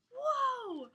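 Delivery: high awed gasp; amazed whoa. Acted. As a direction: gasp at the wonder. Amazed at the tree.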